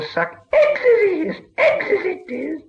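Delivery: high gloating creature voice with a chuckle; cackling gleeful Gollum. A narrator's reading. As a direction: crow over it like a pleased creature.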